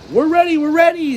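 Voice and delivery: high and fast, bouncing with glee; high, quick sing-song excitement; excited singsong. A narrator's reading. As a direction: chant it like you can't wait. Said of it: "SpongeBob sang".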